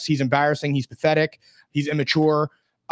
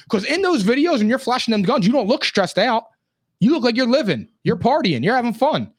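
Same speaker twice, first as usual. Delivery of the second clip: high, animated, insistent delivery; incredulous scolding; 7 semitones higher than usual. Real, not acted. Host calling out a player who claims stress, incredulous. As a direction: pitch it up, hammering each accusation.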